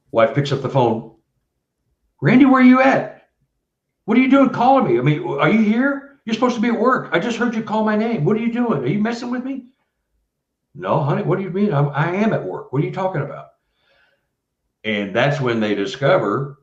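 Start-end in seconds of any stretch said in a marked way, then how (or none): 2.2-9.7 a wife startled by a phone call; mock panicked wife on the phone, high pitched; alarmed wife. 10.7-13.5 puzzled husband. Retold or imitated. Husband answers on the phone.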